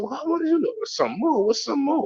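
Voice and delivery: high-pitched, incredulous questioning; acted-out disbelief at being charged extra; mock bafflement. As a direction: question the upsell in a puzzled high voice. Acts his reply to upsell.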